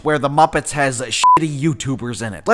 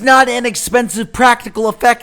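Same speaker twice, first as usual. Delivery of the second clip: angry rant; 7 semitones higher, 3 dB louder than usual. Real, not acted. Escalating rant about cheap effects.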